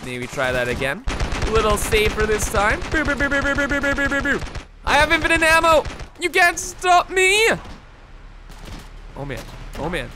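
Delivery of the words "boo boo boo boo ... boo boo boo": imitated gunfire. Mimicking gunfire sounds.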